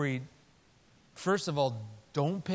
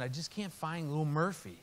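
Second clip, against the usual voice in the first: ordinary talk, nothing marked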